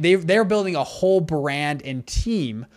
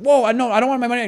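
startled protest; 7 semitones higher, 4 dB louder, much faster than usual. Retold or imitated. Buddy protesting refund.

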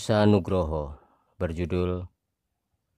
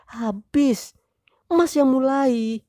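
high, loud wife voice, slow blame; blaming wife; 4 dB louder, slower than usual. Acted. Wife blaming husband for starting it, high voice.

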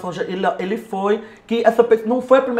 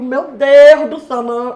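loud, drawn-out exclamation, raised pitch; retold disbelief; 4 semitones higher, 7 dB louder, slower than usual. Helper learning who badmouthed him, retold disbelief. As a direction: replay the shock, slow and emphatic at first. Retold shock at a friend's betrayal.

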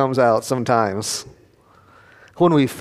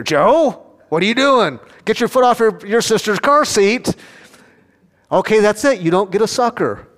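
mom scolding; 4 semitones higher than usual. Retold or imitated. Mother scolding child in car.

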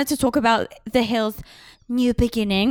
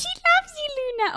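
dog voice; 4 dB quieter than usual. Acted. Talking to the dog.

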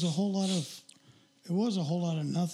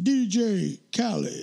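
[name] tag; 2 semitones higher, 4 dB louder, much slower than usual. Retold or imitated.

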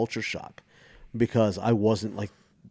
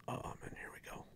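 hushed inner-thought whisper; barely audible, resigned aside; whispered dread; 21 dB quieter than usual. Retold or imitated. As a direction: whisper it to yourself, bracing for trouble. Dreading being called over by owner.

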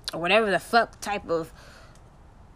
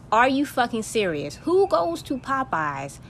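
usual speaking voice

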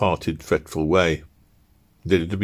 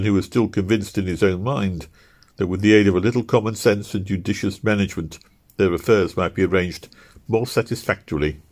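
ordinary delivery, nothing marked

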